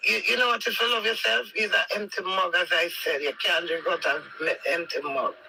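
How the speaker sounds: ordinary talk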